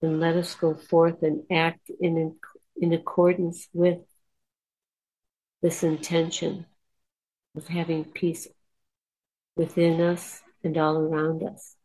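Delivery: soft, unhurried meditation voice; guided meditation. Acted. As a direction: lead the listeners calmly inward.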